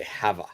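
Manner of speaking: "Have a" clipped to hava; shortened "have a". Shown as a demonstration. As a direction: make "Have a" one quick sound. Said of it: "make that shorter".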